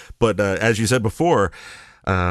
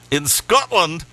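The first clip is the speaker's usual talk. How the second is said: put-on Scottish accent; 7 semitones higher, much slower than usual. Host echoing the guest's tour stop, Scottish accent. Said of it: "my Scottish accent".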